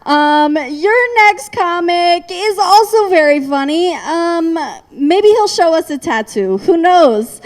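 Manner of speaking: ordinary talk, nothing marked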